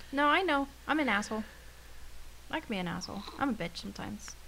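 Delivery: normal talking voice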